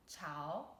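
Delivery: 炒 said with a low dipping chǎo that rises at the end; teacher showing viewers the third tone on 炒, slow demo. Shown as a demonstration. Dip low and come back up on 炒. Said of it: "third tone".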